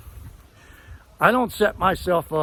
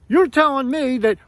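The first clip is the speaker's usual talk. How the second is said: mock angry yell, higher and louder; imitating a yell; 6 semitones higher, 4 dB louder than usual. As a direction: act out the dentist snapping back. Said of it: "he starts yelling at me".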